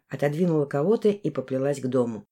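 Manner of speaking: ordinary delivery, nothing marked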